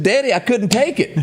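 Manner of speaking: high, breaking into laughter mid-punchline; can barely get the line out for laughing; laughing through it. Real, not acted.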